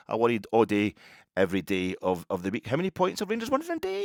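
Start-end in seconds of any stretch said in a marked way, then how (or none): 2.7-4.0 high, hammed-up mock Welsh title; lightly mocked Welsh accent. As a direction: announce it like the Welsh lad's video. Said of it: "I can't do the Welsh accent".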